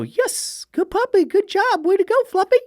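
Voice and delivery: put-on very high, cooing baby talk praise; owner praising puppy. Owner gushing praise at the dog in high baby talk. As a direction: gush at the puppy like a doting owner. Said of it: "Most people go".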